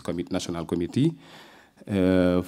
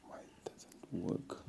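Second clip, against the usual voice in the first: whispered aside, slow; whispering; 19 dB quieter, slower than usual. Real, not acted. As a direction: mutter it under your breath. Muttering to himself at slide.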